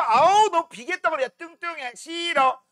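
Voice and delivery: mock picky girl turning down a suitor; lightly voiced choosy girl; fussy girl amylase. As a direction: amylase the enzyme snubbing protein, mock fussy. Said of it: "지방 오빠".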